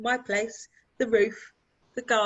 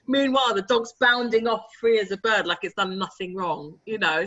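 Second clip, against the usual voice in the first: normal talking voice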